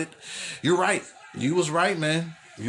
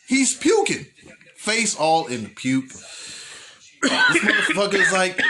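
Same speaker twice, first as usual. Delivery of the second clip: usual speaking voice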